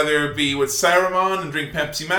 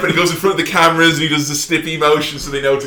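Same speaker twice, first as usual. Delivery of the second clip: usual speaking voice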